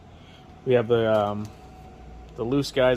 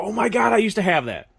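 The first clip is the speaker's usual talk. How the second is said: higher-pitched excited gush, imitating customers; delighted customer reaction; 8 semitones higher, 3 dB louder than usual. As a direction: mimic a thrilled exclamation of recognition, pitched up.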